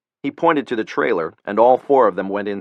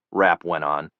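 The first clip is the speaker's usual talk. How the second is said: usual speaking voice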